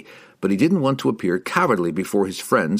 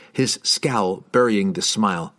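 ordinary delivery, nothing marked

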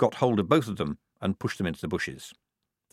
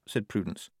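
ordinary delivery

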